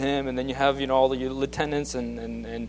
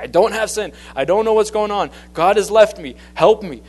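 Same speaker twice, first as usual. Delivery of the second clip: raised, higher voice crying out that he has no sin; Job, worn out by his friends' accusations, crying out to God; desperate plea; 6 semitones higher, 8 dB louder than usual. Retold or imitated. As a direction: plead loudly as a man at the end of his rope. Job pleads, friends accuse again.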